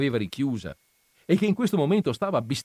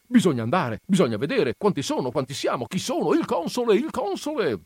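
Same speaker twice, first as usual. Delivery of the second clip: rising to very high calls; excited villagers calling for the consul; agitated crowd; 8 semitones higher than usual. Acted. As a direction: clamour for the consul.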